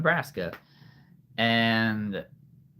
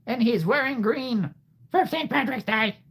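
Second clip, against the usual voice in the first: much higher, squeaky aside; 12 semitones higher than usual. Shown as a demonstration. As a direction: pitch it way up. Toy figure voiced high.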